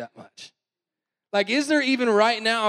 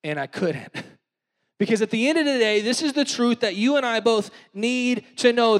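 ordinary delivery

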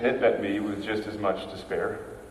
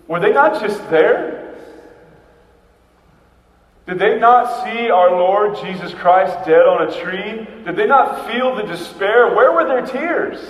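raised, louder incredulous questions; bewildered disbelief; 7 semitones higher, 8 dB louder than usual. Acted. Thomas to the joyful disciples, loud and bewildered. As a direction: demand why they aren't grieving.

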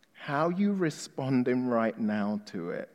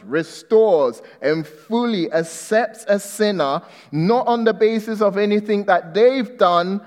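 loud, high preaching at full force; 6 semitones higher, 5 dB louder than usual. Acted. Preacher to his church, loud fervent preaching. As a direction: proclaim it to the congregation.

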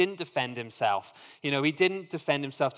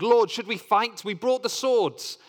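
higher and louder, eager to fight; urgent exclamation; 9 semitones higher, 5 dB louder than usual. A narrator's reading. Disciples urging their Lord to fight, excited. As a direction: burst out asking to take up swords. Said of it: "they exclaimed".

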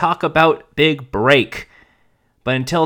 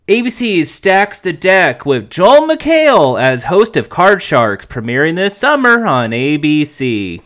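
loud, punchy promo announcer; announcer headline read; 3 semitones higher, 4 dB louder than usual. Acted. Podcast host reading out the bad news headline, loud announcer style.

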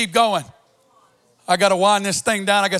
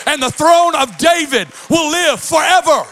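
full-force preaching; 5 semitones higher than usual. Acted. Pastor preaching God's promise to the congregation, shouting. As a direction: build it into a full-throated sermon cry.

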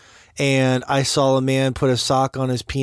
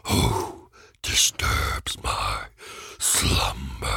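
very slow, deep rumbling stone voice; grinding stone statue; 4 dB quieter, much slower than usual. Pretend. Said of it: "That's much better".

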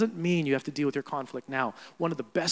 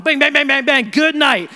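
mock verbal barrage; 8 semitones higher, 10 dB louder than usual. Mimics unloading on someone.

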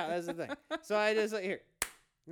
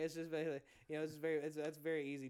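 ordinary talk

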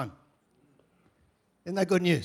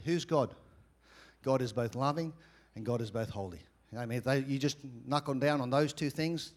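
quiet teaching; 6 semitones lower, 7 dB quieter than usual. Acted. Softer close teaching aside.

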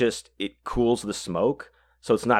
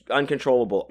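ordinary delivery, nothing marked

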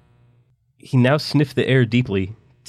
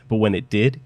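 ordinary delivery, nothing marked

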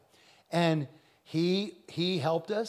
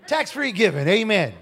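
loud, high church call-out; loud exclamation; 4 semitones higher, 10 dB louder than usual. Real, not acted. Preacher to congregation on tax free giving, loud exclamation.

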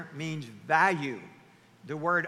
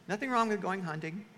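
usual speaking voice

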